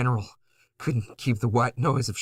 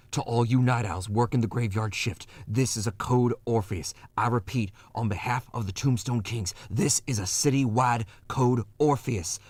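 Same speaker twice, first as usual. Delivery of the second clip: a lightly urgent radio alert to night workers; emergency broadcast. Acted. Radio alert to the graveyard shift, lightly urgent. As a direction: sound the alarm over the airwaves.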